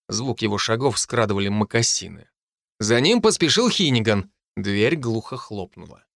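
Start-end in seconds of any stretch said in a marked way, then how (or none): none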